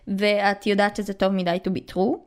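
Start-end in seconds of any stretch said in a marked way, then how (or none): 1.7-2.2 English in an Israeli accent; Hebrew-accented English. Real, not acted. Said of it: "יצא לי מבטא ישראלי".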